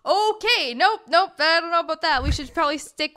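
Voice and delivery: high loud alarmed nope; startled protest. Real, not acted. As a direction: jump in, shocked and refusing. Rejecting a joke claim.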